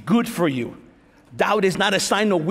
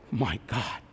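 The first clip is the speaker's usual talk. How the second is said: much lower, much softer my God; stunned hush; 11 semitones lower, 11 dB quieter than usual. Pretend. Preacher voicing a crushed believer, hushed stunned gasp. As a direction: breathe it out in shock.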